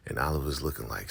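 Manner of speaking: normal talking voice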